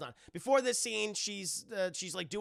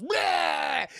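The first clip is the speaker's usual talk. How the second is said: much higher, loud mock scare cry; demon roar; 13 semitones higher, 10 dB louder than usual. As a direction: jump out with a loud bleh.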